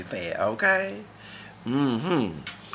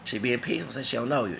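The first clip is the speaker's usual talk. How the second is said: normal talking voice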